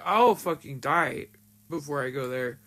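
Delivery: usual speaking voice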